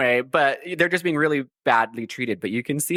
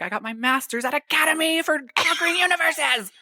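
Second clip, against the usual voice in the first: hammed-up high-pitched outrage; mock indignation; 11 semitones higher than usual. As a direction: imitate an indignant overqualified underling, pitched up. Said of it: "That's why he's so mad".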